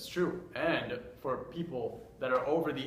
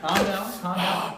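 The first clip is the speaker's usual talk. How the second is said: loud calm down; 6 semitones higher, 8 dB louder, much slower than usual. Acted. Co-host calms the ranting host.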